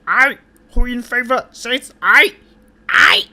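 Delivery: mocking the council's shouted votes; mock chorus shouts. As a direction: bark out each I like a roll call. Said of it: "everyone says".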